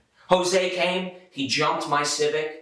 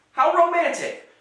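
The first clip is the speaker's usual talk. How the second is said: sarcastic; 13 semitones higher, 4 dB louder than usual. Real, not acted. Sarcastic high remark.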